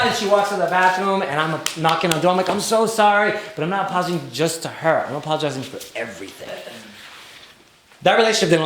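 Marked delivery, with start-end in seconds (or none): 2.5-3.3 higher, louder mock apology; retold pleading. Boyfriend knocking on the bathroom door, retold pleading. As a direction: call it through a closed door, imploring.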